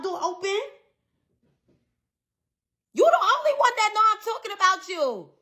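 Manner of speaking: high-pitched indignant retort, replaying the argument; mad, incredulous. Telling off the friend who took offence at "dummy", mad. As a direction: challenge them in disbelief, voice pitched right up. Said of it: "I was so fucking mad after that".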